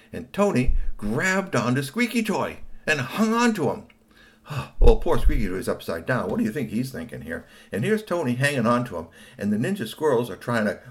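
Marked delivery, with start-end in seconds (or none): none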